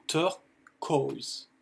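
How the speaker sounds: turquoise broken slowly into "tur... koyz"; slow English pronunciation. Shown as a demonstration. Teacher breaks the word down for learners, slow English pronunciation of turquoise. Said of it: "ça se prononce O E".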